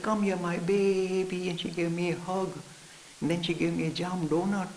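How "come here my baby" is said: tender mommy. Retold or imitated. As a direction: soothe a crying child. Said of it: "she saw me crying".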